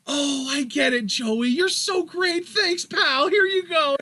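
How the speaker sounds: high-pitched, gushing anime hero voice; Yugi. Acted. Yugi thanking Joey for the cards, high gushing voice. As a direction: gush gratefully in a high cartoon voice. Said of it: "he's just like".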